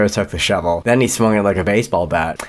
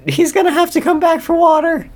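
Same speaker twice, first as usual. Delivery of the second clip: the warden's voice. Acted. Warden waves off the guards in a very high squeaky voice. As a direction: play the warden as a squeaky cartoon villain. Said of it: "the warden said".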